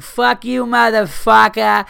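put-on shrieking drunk Boston woman, high and loud; high, loud mock tirade; drunk girl yelling. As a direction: scream it like a furious drunk girl outside a club. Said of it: "she's just like".

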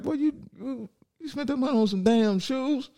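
high-pitched mock scolding dad; dad's disbelief. Dad questioning his son's shoe spending, high mock scolding. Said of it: "he was like".

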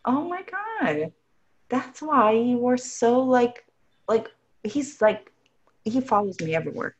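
suddenly seeing why the dog follows her; amazed realization. Retold or imitated. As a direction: let the realization hit you. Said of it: "now I was like".

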